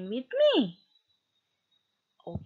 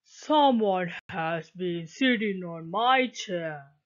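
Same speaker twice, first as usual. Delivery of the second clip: lower, big bear voice; Papa Bear; 5 semitones lower than usual. A narrator's reading. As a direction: speak like a heavy old papa bear. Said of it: "Papa Bear said".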